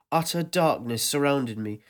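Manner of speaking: ordinary delivery, nothing marked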